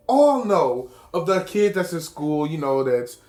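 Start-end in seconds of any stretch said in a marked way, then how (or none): none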